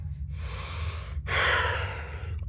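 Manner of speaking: long, breathy exasperated sigh; heavy sigh of frustration; weary sigh. Acted. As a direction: let out a tired, impatient breath. Frustrated official ends argument.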